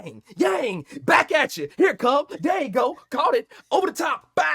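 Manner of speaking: loud, high hyped calling of every hit. Retold or imitated. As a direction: shout each shot like a stoned commentator. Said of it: "Snoop was like".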